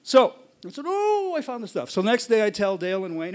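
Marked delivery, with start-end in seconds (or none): 0.9-1.9 high-pitched "ooh" of delight; mildly hammed-up thrill at a find; excited discovery. Retold excitement at his find.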